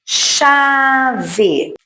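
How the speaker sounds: "Chave" drawn out but joined, high then falling; palavra modelo. Shown as a demonstration. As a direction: say "Chave" as one slow model word. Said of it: "eu falo a palavra". ("Chave" esticado, sem separar muito)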